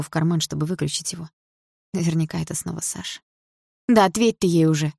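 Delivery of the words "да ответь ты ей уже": cold smile. A narrator's reading. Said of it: "улыбнулась я холодно".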